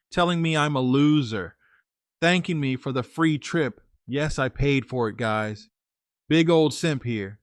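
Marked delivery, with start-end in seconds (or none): none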